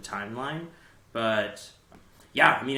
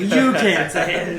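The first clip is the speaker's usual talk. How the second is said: loud teasing; 6 semitones higher, 12 dB louder, slower than usual. Real, not acted. Teasing, laughing loudly.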